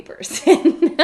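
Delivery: breaking into a laugh at the end; quoting someone while starting to laugh. Real, not acted. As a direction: let real laughter spill out as you finish. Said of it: "I'm sorry that I'm laughing".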